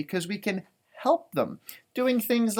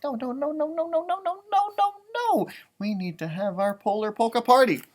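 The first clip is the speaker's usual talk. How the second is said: rising, higher string of no's; pleading polar bears; 6 semitones higher than usual. Acted. Bears refusing to lose their party, rising protest. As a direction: insist more with each no.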